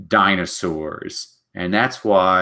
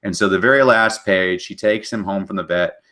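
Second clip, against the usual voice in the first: ordinary talk, nothing marked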